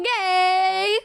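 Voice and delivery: high, long drawn-out word; gleeful gushing. Real, not acted. Gushing over a photo together, high and gleeful. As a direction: stretch it out in a delighted squeal.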